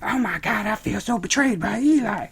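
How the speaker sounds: whiny mock falsetto; hammed-up, high-pitched lament; Emma. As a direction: wail it in a mocking squeal. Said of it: "Emma's just standing here like".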